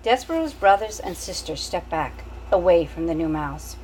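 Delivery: ordinary delivery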